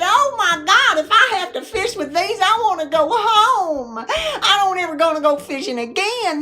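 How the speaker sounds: high-pitched comic complaint; mock horrified outburst. Wife giving up on fishing after dropping the worm, mock horror. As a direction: protest high and exasperated, hammed up.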